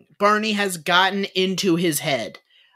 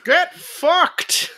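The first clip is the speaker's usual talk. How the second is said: Jimmy Stewart cursing; 10 semitones higher, 3 dB louder than usual. Retold or imitated.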